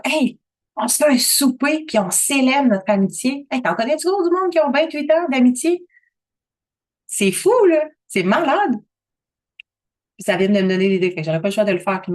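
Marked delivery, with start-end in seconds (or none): 0.0-3.5 excited invitation. Retold or imitated. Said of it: "faire comme". 3.5-8.8 amazed excitement. Real, not acted. Talking to viewers about her best friend, amazed and excited.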